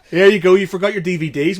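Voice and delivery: cheeky friend. Retold or imitated. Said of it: "he went".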